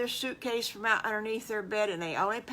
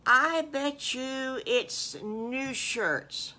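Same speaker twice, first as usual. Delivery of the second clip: a lightly voiced little pig, slow and a bit higher; lightly voiced piglet guessing; 6 semitones higher, 4 dB louder, much slower than usual. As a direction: drawl it out like a small piglet. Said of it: "Piggly says".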